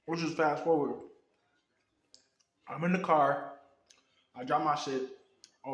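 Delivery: talking around ice cubes; words round a mouthful of ice. Real, not acted. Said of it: "I got ice in my mouth".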